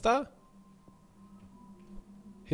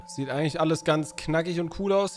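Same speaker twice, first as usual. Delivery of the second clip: ordinary talk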